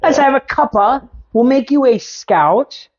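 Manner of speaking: high, hammed-up cockney chirp; jokey fake British accent, innit; mock British accent. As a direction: play a cheeky English bloke. Mock Brit voicing scouts.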